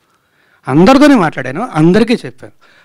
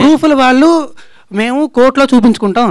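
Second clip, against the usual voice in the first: raised-voice rebuttal; 6 semitones higher than usual. Real, not acted. Accused man rebutting reporters about proofs, raised voice. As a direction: push over the questioners, pitched up.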